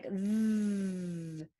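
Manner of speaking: long buzzing "th"; voiced TH. Shown as a demonstration. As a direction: hold "th" with the voice on. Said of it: "Sometimes the voice is on".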